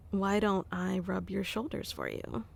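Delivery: ordinary delivery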